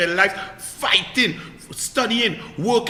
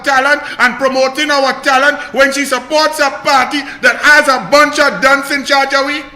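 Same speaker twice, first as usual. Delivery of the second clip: raised, ranting voice at full volume; angry shout; 4 semitones higher, 6 dB louder than usual. Real, not acted. Political commentator shouting down a columnist, angry. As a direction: blast her hypocrisy loudly.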